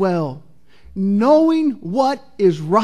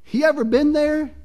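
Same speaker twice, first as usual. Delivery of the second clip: ordinary talk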